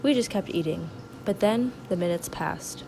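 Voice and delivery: hushed storyteller voice; dramatic narration. Acted. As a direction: narrate it like a soap opera. Quiet dramatic reenactment narration.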